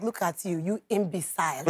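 low, quiet contemptuous put-down; mock disdain. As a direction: dismiss them coolly, voice dropped. Imitating Nigerian relatives insulting someone.

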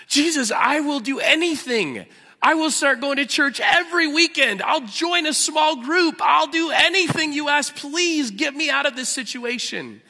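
high-pitched, loud, overwrought begging; gushing relief turning into frantic promises; desperate pleading. Acted. Jack on the ledge, begging Jesus to save him.

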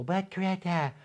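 slow, put-on scolding granny voice; mock the old lady, drawn out; hammed-up granny lecturing Greta; mock Granny Petrol. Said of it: "that stupid Granny Petrol".

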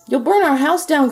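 loud, high alarmed cry; a mother crying out as the roof burns; frantic cry. A narrator's reading. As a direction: cry out in panic. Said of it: "cried his mother".

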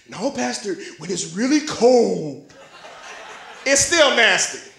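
lightly voiced pleading churchgoer; mock persuasion, drawn out; protesting congregant. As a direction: stretch it out like a coaxing objector. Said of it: "I know what you're thinking".

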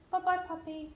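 high, soft bye-bye puppy; dog goodbye. Real, not acted. Trainer softly saying goodbye to her dog.

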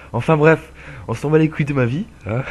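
playful loud shrug-off; mock dismissive outburst. Real, not acted. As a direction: cut yourself off with a laugh in the voice. Self-mocking dismissal of own rant.

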